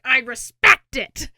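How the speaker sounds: loud, drawn-out mock cheer from a dying character; gleeful admiration. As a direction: shout it slowly, hammed-up approval. Retold dying character cheering betrayal.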